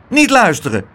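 high, loud, curt little doorman; sharp little command; snappish. A narrator's reading. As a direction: order it tartly in a high voice. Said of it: "zei hij bits tegen Pluk".

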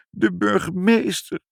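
high-pitched tearful wail; sobbing child. A narrator's reading. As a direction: sob it out like an upset child. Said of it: "snikte Ibbeltje". (snikkend, met hoge huilstem)

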